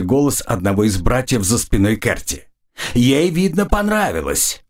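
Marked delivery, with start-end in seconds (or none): 2.9-4.6 high-pitched teasing taunt; mocking jeer. A narrator's reading. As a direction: jeer at the pair in a raised pitch. Brothers jeer at the kissing girl. (насмешливо, дразнящим высоким голосом)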